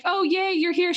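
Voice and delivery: high, bright mock cheer; mock cheerful. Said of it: "it's not like".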